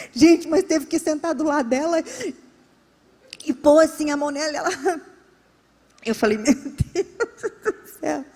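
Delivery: cracking up mid-anecdote; laughing while talking. Real, not acted. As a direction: tell it through helpless laughter. Laughs telling of laughing woman.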